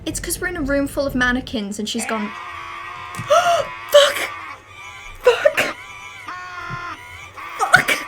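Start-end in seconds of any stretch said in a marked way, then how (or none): 2.4-3.9 a jump-scare gasp; startled gasp. Real, not acted. 3.9-8.0 very loud, high screamed curse; a shriek of pure fright; terrified scream. Real, not acted. Jump scare in game.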